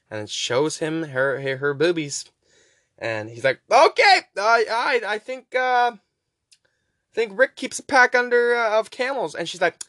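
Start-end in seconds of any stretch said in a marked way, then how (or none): 3.7-9.3 much higher, loud, goofy eager voice; eager boyfriend. Retold or imitated. Mike agreeing to get Leslie smokes, goofy eager voice. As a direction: jump at the chance to please her.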